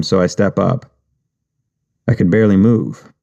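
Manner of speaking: ordinary delivery, nothing marked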